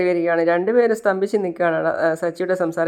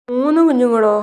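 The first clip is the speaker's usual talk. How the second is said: shocked disbelief; 6 semitones higher, 5 dB louder than usual. Retold or imitated. Husband sees third baby arrive.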